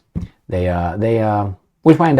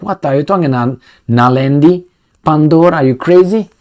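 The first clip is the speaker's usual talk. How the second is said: mock disbelief; 5 semitones higher, 4 dB louder than usual. Everybody mocking the minister.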